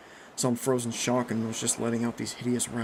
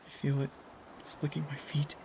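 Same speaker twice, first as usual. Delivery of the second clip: hushed, eerie monotone; 4 semitones higher, 7 dB quieter than usual. A narrator's reading. Jeff from the dark as it licks him, monotone. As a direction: say it softly, as if in a trance. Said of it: "a monotone voice said".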